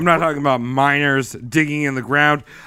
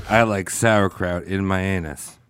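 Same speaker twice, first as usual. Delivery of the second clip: low, slow mock demon voice; demon voice demo; 11 semitones lower, 6 dB quieter, slower than usual. Shown as a demonstration. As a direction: drop low and drag it out like a silly demon. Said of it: "In that voice, say, like".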